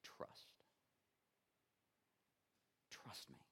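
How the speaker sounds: preacher naming the answer in a whisper; hushed answer. Real, not acted. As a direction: lean in and breathe the word. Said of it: "what is that greater emotion?".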